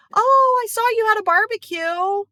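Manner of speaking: mock a friend sulking about missing a barbecue; passive aggressive. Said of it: "start being passive aggressive".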